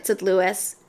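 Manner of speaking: ordinary delivery, nothing marked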